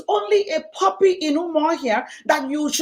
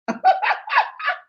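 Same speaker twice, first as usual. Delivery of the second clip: high laugh; 3 dB louder than usual. Real, not acted. Laugh after the quote.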